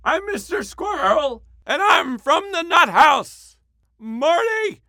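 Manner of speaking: high squeaky cartoon squirrel, slow; chirpy rodent, drawn out. Shown as a demonstration. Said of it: "perform our voices".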